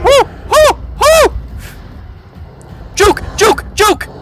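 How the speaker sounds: whooping high shouts; excited yells while dodging; being chased. Real, not acted. Dodging the monster.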